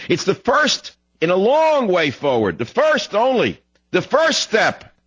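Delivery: "first" drawn out long and very high; slow, high hammering of "first" in each phrase; stress on "first". Real, not acted. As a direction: stretch "first" and "long" with scornful emphasis. Mocking the quoted first step.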